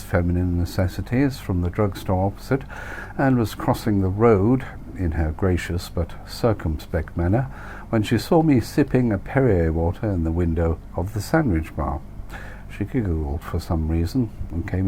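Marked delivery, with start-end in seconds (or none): none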